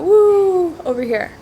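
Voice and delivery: drifting off mid thought; airy, wandering off; spacey distracted. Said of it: "kinda go".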